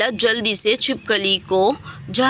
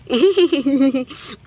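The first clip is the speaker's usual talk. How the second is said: amused laugh. Acted. Gossiping laugh about the couple.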